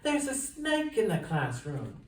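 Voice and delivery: high, frightened outcry; alarmed shout. A narrator's reading. Frightened pupils warning about the snake, high alarmed cry. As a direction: cry out the alarm like scared kids. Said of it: "they shouted".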